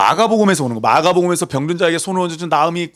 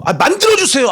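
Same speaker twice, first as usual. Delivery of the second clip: high, loud pestering demand; acted insistent pleading; 13 semitones higher, 5 dB louder than usual. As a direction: nag like a crowd that won't take no. 백성이 아론에게 조르는 재연. (높고 크게 떼쓰며 조르는 흉내)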